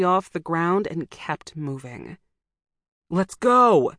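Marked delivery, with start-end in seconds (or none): 3.1-3.9 loud urgent scream. A narrator's reading. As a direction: yell it at a frozen brother. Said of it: "he screamed at my brother".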